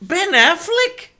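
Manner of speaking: disbelief. Real, not acted. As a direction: drag the name out, appalled. Repeats name in disbelief.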